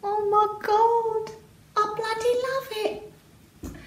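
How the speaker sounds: high, slow sing-song gushing; sing-song delight. Real, not acted. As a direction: coo over the fabric like a song. Delighted at mermaid fabric.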